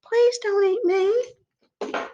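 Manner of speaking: tiny goat. Acted. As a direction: squeak it out like a scared little goat. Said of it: "that little goat said".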